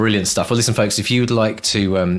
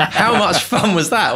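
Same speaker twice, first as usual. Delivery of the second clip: laughing; 9 semitones higher, 3 dB louder, slower than usual. Real, not acted. Host laughing delightedly.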